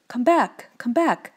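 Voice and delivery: high, urgent call. A narrator's reading. Woodman to the fleeing mice, calling them back. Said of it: "while he called to the mice".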